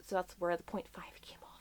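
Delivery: sheepish whisper; hushed confession. Real, not acted. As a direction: whisper it as an aside. Confessing aside.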